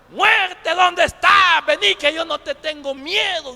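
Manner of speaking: high, loud dare called out to death; hammed-up fearless yell, easing slightly; acted shouting. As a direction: shout out boldly as if alone on a mountain. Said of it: "quedó solo y empezó a gritar".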